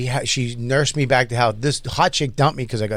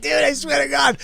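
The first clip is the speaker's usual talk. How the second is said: high laugh into a shout; 11 semitones higher than usual. Real, not acted. Swearing it was true, laughing shout. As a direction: burst out laughing and yelling.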